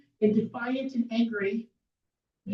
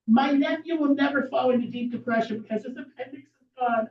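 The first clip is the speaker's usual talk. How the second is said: ordinary delivery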